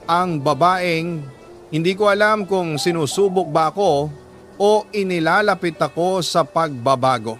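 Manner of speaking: usual speaking voice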